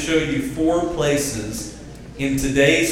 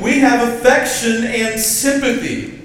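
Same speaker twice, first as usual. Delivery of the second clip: higher, louder rousing preaching; preacher's call for amen; 9 semitones higher, 4 dB louder than usual. Shown as a demonstration. Preacher drawing an amen from church, loud and high. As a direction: pump it up for the congregation to answer. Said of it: "a preacher like Paul could say it like this".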